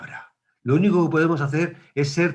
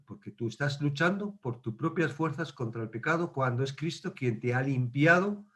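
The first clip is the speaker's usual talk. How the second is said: usual speaking voice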